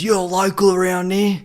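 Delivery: a hint of a rough old stranger; homeless man voice. Old homeless man asking if he's local, lightly voiced. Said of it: "He goes".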